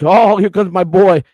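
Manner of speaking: big slow hearty hello; imitating proud greeting. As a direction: hail your favorite kid as he walks in. Owner greets him proudly on arrival.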